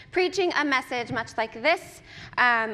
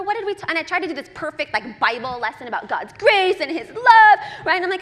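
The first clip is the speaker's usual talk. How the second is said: ordinary talk, nothing marked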